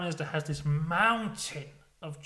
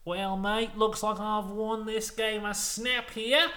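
higher put-on Australian voice; Australian Prime Minister; 6 semitones higher, slower than usual. Prime Minister claiming his win at Snap, mock Australian voice. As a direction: play a mock Australian, pitched up and drawn out. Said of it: "And the Australian Prime Minister goes".

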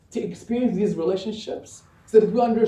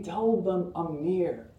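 tender, slow; 6 dB quieter, much slower than usual. Acted. Quoting God's tender promise.

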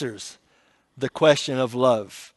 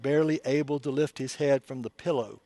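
normal talking voice

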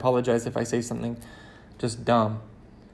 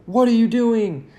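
mock angry; 8 semitones higher, 4 dB louder than usual. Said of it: "I do something angry".

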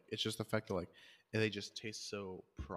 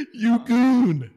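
high, very loud mock-insult yell; whooping shouted jeer; gleeful taunt; 13 semitones higher, 19 dB louder than usual. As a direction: crow it at your victim, way up high. Crowing after the prank lands.

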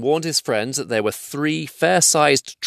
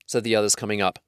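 usual speaking voice

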